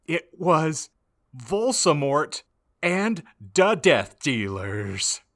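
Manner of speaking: normal talking voice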